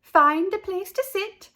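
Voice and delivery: high, loud bright teacher; cheerful teacher. A narrator's reading. Miss Pimento addresses class.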